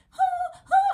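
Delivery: sharp high yelping pants; imitated dog yelps. Retelling how her old dog yelped in pain, imitated yelps. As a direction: mimic a hurt dog's yelps. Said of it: "and she was like".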